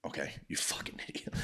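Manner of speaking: whispered, exasperated aside; muttered insult under breath. Real, not acted. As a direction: whisper the insult so the customer can't hear. Aside about the customer.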